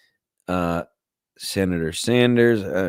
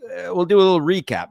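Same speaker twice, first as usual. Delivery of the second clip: normal talking voice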